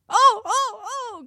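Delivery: very high, loud shrieking queen; hammed-up royal shrieks; piercing cries. A narrator's reading. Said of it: "pousser des cris si perçants et si retentissants".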